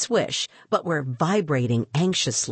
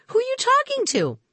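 put-on high puzzled girl; faster than usual. Girl confused by talk.

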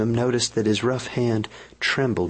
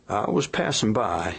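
apologetic partner; 2 semitones higher than usual. A narrator's reading. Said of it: "he began by way of apology".

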